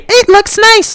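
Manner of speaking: imitating a girl praising his beard, high; girls' compliment. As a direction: mimic a girl gushing sweetly. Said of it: "If they genuinely say".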